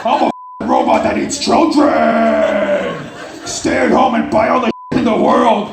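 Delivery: hammed-up killer robot; mock monster voice. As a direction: growl it like a cartoon villain. Voicing the scary variant.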